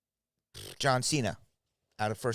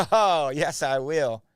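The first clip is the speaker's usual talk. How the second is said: laughing reply; 2 semitones higher, 6 dB louder, much slower than usual. Real, not acted.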